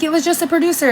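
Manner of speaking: high-pitched mock pleading; imitated defensive protest. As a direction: imitate someone protesting innocence. Retold quarrel, defensive reply.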